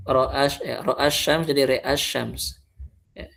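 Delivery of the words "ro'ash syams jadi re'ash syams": re'ash syams with only the ro bent to e; re'ash syams, ra leaning to e, then plain a; connected reading re'ash syams; imalah on ro only. Shown as a demonstration. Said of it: "Gimana bacanya?".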